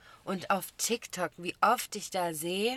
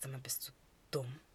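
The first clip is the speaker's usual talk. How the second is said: whispered disbelief; hushed, scornful aside; incredulous whisper; 5 semitones lower, 8 dB quieter than usual. Retold or imitated. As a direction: mutter it quietly to yourself. Retold silent thought at yelling guy.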